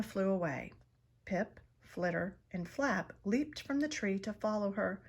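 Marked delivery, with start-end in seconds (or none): none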